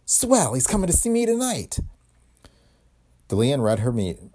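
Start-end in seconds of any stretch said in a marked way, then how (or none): 0.0-1.8 high, sharp vengeful glee. Acted. Dame relishing her chance at revenge. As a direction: savour the revenge.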